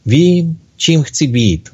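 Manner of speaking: understated storybook excitement; great enthusiasm. A narrator's reading. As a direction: announce it eagerly, lifting each "Vím". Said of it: "s velkým nadšením".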